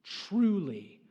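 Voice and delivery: hushed telling. Acted. Preacher softly describes the Israelites' new freedom. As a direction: let the awe settle quietly.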